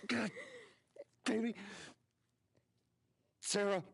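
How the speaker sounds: high, loud, anguished urging rising to a scream; desperate pleading. Acted. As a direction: beg an injured child to hold on, building to a shout. Acted scene, father with wounded daughter.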